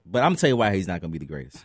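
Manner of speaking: ordinary delivery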